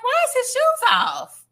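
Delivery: high, loud and slow disbelief; can't believe what you're seeing; incredulous. Retold or imitated. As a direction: squeal it out, baffled, stretching it slow. Baffled watching the video.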